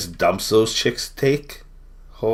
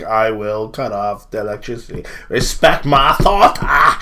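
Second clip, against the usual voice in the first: blustering tough talker; 3 semitones higher, 7 dB louder than usual. Retold or imitated. Mocking the premier threatening a power cut, blustering voice building to a yell. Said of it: "He's just like".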